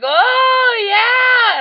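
loud high impressed shout; crowd cheer. Retold or imitated. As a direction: whoop it up like a crowd. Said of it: "and everyone's like".

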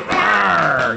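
witch growl. Acted. Wicked Witch of the East arrives.

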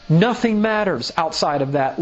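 usual speaking voice

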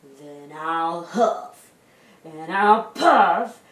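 slow threat building to a loud, high howl; big bad wolf winding up, then howling. A narrator's reading. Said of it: "said the wolf".

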